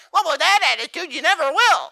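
high loud mocking copy of her whine; young preacher mocking the lady, high mimicry; mimicking her tone. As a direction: throw her own whiny voice back at her. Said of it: "mimicked her exact tone of voice".

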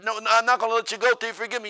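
high, loud refusal to let go; preacher gripping her hand, loud insistence. Retold or imitated. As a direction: dig in and insist.

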